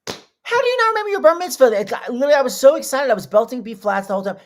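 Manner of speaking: very high, loud, excited outburst; aghast disbelief. Real, not acted. Host appalled guest forgot his bar mitzvah, high-pitched incredulity. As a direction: protest in a high, rushing, scandalised voice.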